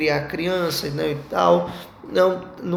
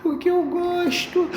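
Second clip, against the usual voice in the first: put-on much higher, slow little-girl whine; voz de criança manhosa; 12 semitones higher, slower than usual. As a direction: mimic a sulky small daughter, drawn out. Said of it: "Aí ela". (imitando a filha pequena, bem mais aguda e lenta)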